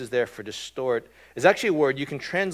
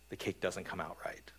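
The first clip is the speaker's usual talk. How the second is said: whispered aside; 5 semitones lower, 11 dB quieter than usual. Real, not acted. Hushed punchline in example.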